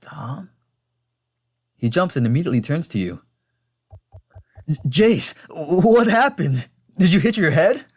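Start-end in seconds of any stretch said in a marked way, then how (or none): 0.0-0.5 very soft questioning name; faint, weak call. Acted. Fallen rider to Tom's back, faint call. 4.7-7.8 higher, louder, stammered worry; alarmed concern. Acted. Tom checking fallen friend for injury, alarmed concern.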